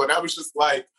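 usual speaking voice

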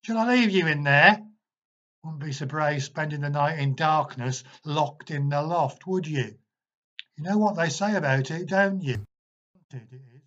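put-on teasing the hidden kids through the door; taunting. A narrator's reading. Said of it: "he shouted through the door".

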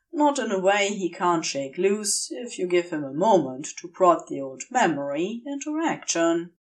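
ordinary delivery

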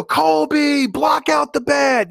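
loud, high, drawn-out cheer; high, stretched-out heckling yell; screaming from the stands. Retold or imitated. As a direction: holler it like a rowdy dad at a ballgame. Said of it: "I'm screaming at the top of my lungs".

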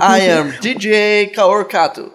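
slow, lightly put-on American accent; drawn-out gringo accent. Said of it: "eu falo com sotaque de gringo".

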